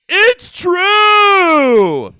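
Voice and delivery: high-pitched shout of It's true. Acted. Shouted repeat.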